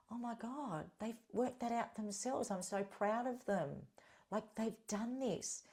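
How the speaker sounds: a softly delighted proud mentor; soft proud joy. Retold or imitated. Proud of clients who moved on.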